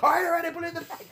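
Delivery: high, rushed panicked backing down; mock freaking out. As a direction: imitate someone hurriedly giving in at gunpoint. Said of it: "Jake was freaking out".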